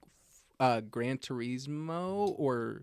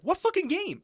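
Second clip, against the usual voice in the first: high, loud mock outburst; mock outraged confusion; 5 dB louder than usual. Teasing a friend who felt left out, high mock outburst. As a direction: blurt it way up high. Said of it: "You're like".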